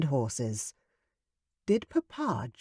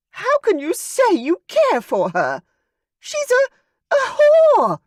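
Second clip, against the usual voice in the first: shrill high screaming mother, much higher and louder; mother screaming at the duke, outright ugly; 9 dB louder than usual. A narrator's reading. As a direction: scream it at the husband, voice cracking with fury. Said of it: "His mother screamed the words, her voice high-pitched".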